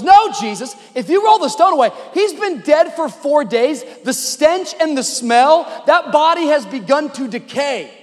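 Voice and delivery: high, loud, urgent objection; alarmed protest. Acted. Martha stopping Jesus at the tomb, alarmed protest. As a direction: plead against it, punching each phrase.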